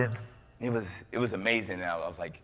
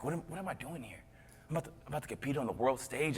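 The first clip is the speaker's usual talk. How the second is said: much softer, rushed self-doubt; hushed, quick worried muttering to yourself; nervous inner voice; 12 dB quieter, faster than usual. Retold or imitated.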